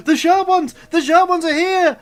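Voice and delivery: very high, loud alarm cry; alarmed shout. Acted. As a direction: yell the warning. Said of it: "You're like".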